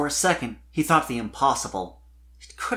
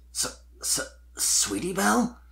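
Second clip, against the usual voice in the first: stuttered, breathless then rising; stammering surprise; 7 semitones higher than usual. A narrator's reading. Spike startled to see Sweetie Belle, stammering. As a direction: trip over the first sound in shock. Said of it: "fighting to formulate his words properly".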